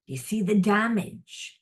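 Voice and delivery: a spirit voice, drawn out and pointed. Retold or imitated. Spirit tells the listener to see the damage, solemn. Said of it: "Spirit's saying".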